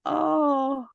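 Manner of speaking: high-pitched adoring coo; real gushing. Real, not acted. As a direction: melt over something adorable, voice way up. Gushing over the adorable baby dragon.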